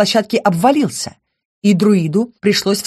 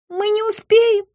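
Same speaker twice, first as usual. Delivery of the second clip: sorrowful moan. A narrator's reading. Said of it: "горестно простонала она".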